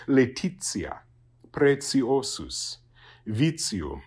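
laetitia, preciosus, vitium with a ts sound before the vowel. Shown as a demonstration. Teacher gives examples of TI before a vowel, laetitia, preciosus, vitium with ts. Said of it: "it becomes ts".

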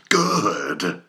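loud, fierce praise; wild approval. A narrator's reading. Ahab praises his cheering crew with wild approval. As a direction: bark it out, thrilled. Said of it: "cried Ahab, with a wild approval".

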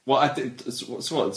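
ordinary delivery

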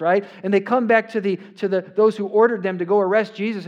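usual speaking voice

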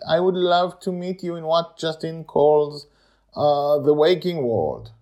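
a touch of wistful longing; lightly voiced friends. Amali and Madeline chat.

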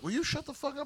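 a hammed-up hushed rebuke; mock hissed scolding. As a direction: snap it under your breath at a heckler. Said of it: "John Wilkes Booth is like".

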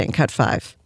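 ordinary talk, nothing marked

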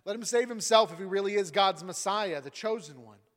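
mock him from below the cross; scoffing. Leaders taunting Jesus, loud scoffing. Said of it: "Leaders scoffed".

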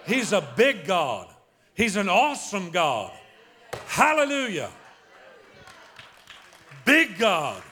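loud, high, punched exclamations; rallying preacher shout. Real, not acted. Preacher firing up the congregation about God, exultant shout. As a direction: proclaim it to the whole room at full force.

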